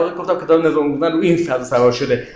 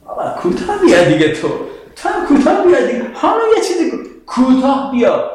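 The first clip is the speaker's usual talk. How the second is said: high-pitched, coaxing parent; parent settling a quarrel; 5 semitones higher than usual. Acted. Parent to two squabbling children, coaxing them to back down. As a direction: plead with the kids to give in, pitch climbing. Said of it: "شما پدر مادر بگید".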